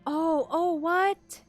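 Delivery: usual speaking voice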